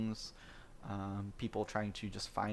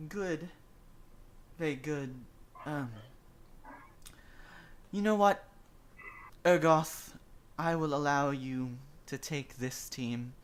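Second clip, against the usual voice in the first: tiefling voice; 3 semitones higher than usual. Acted. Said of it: "the tiefling says".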